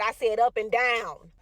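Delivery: "up and down" slow, loud and deliberate; emphatic correction. Retold or imitated. Mother corrects her son's mishearing, "up and down" slow and emphatic. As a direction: drag out "up and down" with force.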